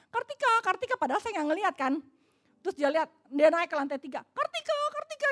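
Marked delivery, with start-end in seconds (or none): 0.1-1.0 imitated yell from upstairs. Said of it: "dia teriak-teriak dari loteng". 4.2-5.3 imitated calling out. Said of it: "dia teriak-teriak".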